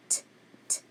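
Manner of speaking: "T" as a bare voiceless consonant; isolated T sound. Shown as a demonstration. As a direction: tap out "T" without naming the letter. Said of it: "T sound".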